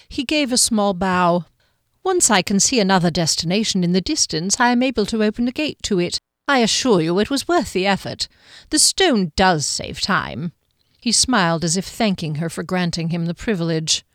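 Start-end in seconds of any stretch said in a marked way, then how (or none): 2.0-10.5 courteous, formal guardian voice; smooth guardian. Acted. Guardian explaining his gates to the woman, smooth and courteous. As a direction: play the guardian, smoothly assuring her.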